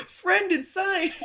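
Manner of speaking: high, loud delighted yell; a loud, gleeful cry of delight; excited shout. Real, not acted. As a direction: shout it out with glee. Excited reaction to game reveal.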